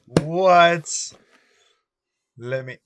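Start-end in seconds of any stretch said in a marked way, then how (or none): 0.1-1.1 surprised. Real, not acted. Delight at the cigar case.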